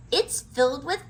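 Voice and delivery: high, loud thrilled announcement; excited. A narrator's reading. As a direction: gush about the books. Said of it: "Annie said".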